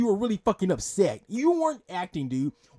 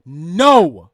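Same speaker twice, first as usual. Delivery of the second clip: louder, shouting the final no; angry shouted no; 3 semitones lower, 11 dB louder than usual. Real, not acted. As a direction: shout him down. Angry rebuttal to Phil.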